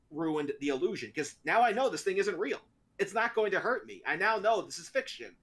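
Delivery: normal talking voice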